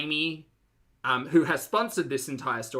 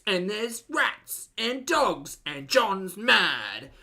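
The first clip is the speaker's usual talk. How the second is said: slow, swooping mock-reporter voice; milk each outrage like a tabloid voiceover; drawn-out, melodramatic current affairs narration; 4 semitones higher, slower than usual.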